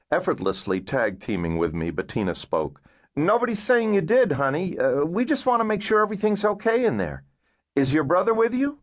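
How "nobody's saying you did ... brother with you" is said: put-on higher-pitched woman coaxing a child; coaxing caseworker. Caseworker coaxing a girl to open up, reassuring voice.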